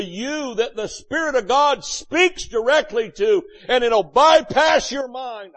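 preaching. Acted. Preacher shouting the point.

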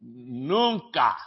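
Nunca, low and final, falling; Spanish nunca. Shown as a demonstration. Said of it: "You need to learn nay".

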